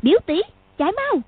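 put-on high child's call to run; a girl urging her cousin to flee. A narrator's reading. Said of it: "gọi".

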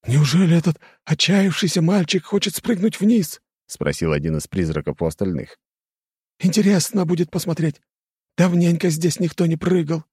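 high, eerie ghost chatter. Acted. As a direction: voice a gossiping ghost. Ghost characters voiced high.

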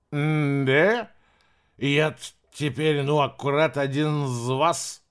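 put-on blissful drunken slur; blackout drunk. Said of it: "заявил стражник с блаженной улыбкой".

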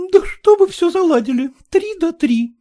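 high, loud scolding woman; shouting at Kutsin. A narrator's reading. Agniya shouting at Kutsin, high and loud. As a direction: snap at him for haggling. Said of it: "закричала на Куцина Агния Васильевна".

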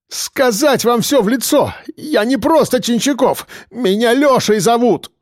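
high, overwrought outburst; nearly shouting. A narrator's reading. Policeman tells prisoners his name, high near-shout. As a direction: cry out to be recognised. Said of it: "почти выкрикнул Чинчаков".

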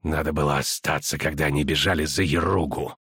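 grind it through clenched teeth; through gritted teeth. A narrator's reading. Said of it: "скрежетнул зубами Риордаен". (сквозь зубы)

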